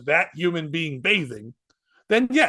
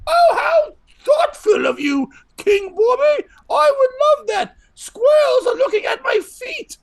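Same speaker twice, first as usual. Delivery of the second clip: high fake lady voice; 6 dB louder than usual. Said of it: "And she'd go".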